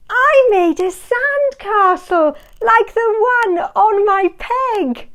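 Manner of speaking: high, bright little-puppy voice; Spot the puppy. A narrator's reading. Young Spot telling about his sandcastle, high puppy voice. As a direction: voice Spot as an eager young pup. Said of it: "said Spot".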